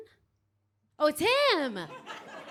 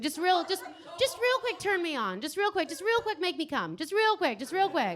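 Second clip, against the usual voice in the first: mock eager pleading; 7 semitones higher than usual. Pleading crowd member.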